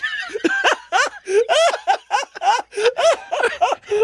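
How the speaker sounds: long, high-pitched laughing fit; uncontrollable laughter. Real, not acted. Hosts reacting to the parrot email, uncontrollable laughter. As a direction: laugh hard until out of words.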